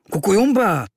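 high, loud reproachful exclamation. A narrator's reading. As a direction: cry "Kukujumba" as a reproach to a friend. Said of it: "řekl trochu vyčítavě". (vyčítavý výkřik)

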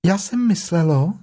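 high little piglet voice; high-pitched, earnest small animal. Acted. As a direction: offer it seriously in a small voice. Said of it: "řeklo prasátko vážně".

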